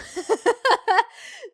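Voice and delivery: loud high laugh. Real, not acted. Amused by own verdict.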